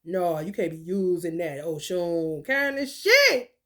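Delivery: low scolding voice; lightly voiced gatekeeper; mock scolding. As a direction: drop low and forbid it. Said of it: "Just somebody told him this".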